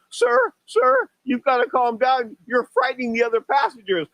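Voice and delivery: high-pitched mock stewardess voice; imitated stewardess. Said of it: "the stewardess comes up to me and says".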